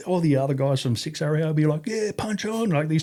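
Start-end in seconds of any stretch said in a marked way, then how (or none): 1.9-2.7 very high cheering; mock crowd cheer. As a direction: egg the fighters on. Soldiers cheering the fight.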